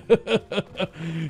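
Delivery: short run of staccato laughter; evenly pulsed chuckle; chuckling. Pretend. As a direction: laugh it off as a friendly cop. Cop laughs off the intimidation.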